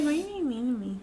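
put-on soft, pouty little-kid voice; baby voice. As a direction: whine it sweetly like a baby. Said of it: "that's how I talk to guys".

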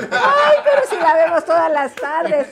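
high, slow, gushing fan voice; hammed-up admirer. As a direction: draw it out high like an excited fan. Acts a gushing fan of her show.

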